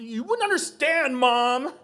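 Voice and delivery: put-on high, shouted teen protest; stroppy teenager. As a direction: blow up at Mom in teenage outrage. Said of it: "he's like a teenager".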